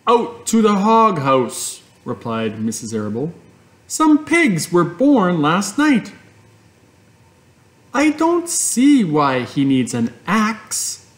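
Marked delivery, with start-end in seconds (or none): 0.1-1.8 lightly voiced mother, higher; calm mother, a touch higher. A narrator's reading. Mother answers Fern at breakfast, lightly voiced. 3.9-6.1 lightly voiced mother, higher; calm mother, a touch higher. A narrator's reading. Mother answers Fern at breakfast, lightly voiced. 7.9-11.1 put-on high, slow girl voice; puzzled little girl, pitched up; young Fern. A narrator's reading. Fern questions her mother, high and puzzled.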